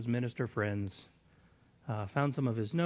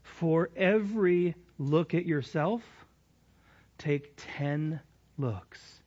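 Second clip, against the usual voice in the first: reading aloud haltingly; 3 semitones higher, slower than usual. Shown as a demonstration. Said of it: "I'm gonna read it slowly on purpose".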